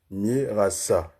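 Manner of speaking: slow, three separated syllables. Shown as a demonstration. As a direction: break the name into slow, distinct beats. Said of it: "trois syllabes".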